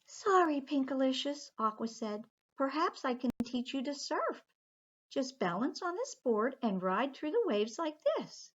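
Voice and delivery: high, sweet little mermaid. Acted. Aqua the mermaid politely telling the children she must go home, high voice. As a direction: lift the voice up high for the tiny mermaid. Aqua apologises, offers surfing.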